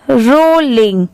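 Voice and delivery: Rolling drawn out as "rol-ling"; repeat-after-me model. Shown as a demonstration. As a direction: stretch rolling for learners to copy. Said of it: "फिर से return repeat करिए". (Rolling खींचकर, दोहराने के लिए)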